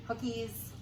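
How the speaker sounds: ordinary delivery, nothing marked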